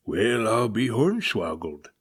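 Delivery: slow, loud, gruff sea captain; old captain grumbles on becalmed deck; growling captain. Acted. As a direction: growl out your dismay. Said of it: "growled the captain".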